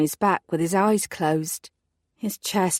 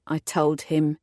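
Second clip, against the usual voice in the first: ordinary delivery, nothing marked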